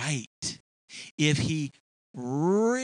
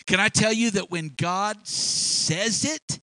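ordinary talk, nothing marked

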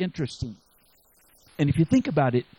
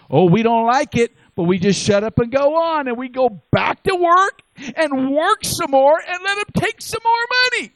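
loud, rising preacher's climax, climbing far higher; a preacher thundering against the tax man; full-force preaching; 9 semitones higher, 6 dB louder than usual. Acted. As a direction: whip the room up to the peak.

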